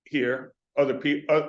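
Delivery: ordinary talk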